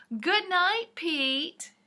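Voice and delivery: called out high and loud; loud sing-song goodnight. Acted. Friends calling a goodnight to Pete loudly. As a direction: sing it out across the room.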